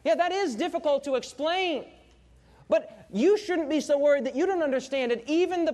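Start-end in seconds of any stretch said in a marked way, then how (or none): none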